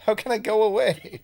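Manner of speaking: high, loud playful protest; mock outrage. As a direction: act offended, loudly.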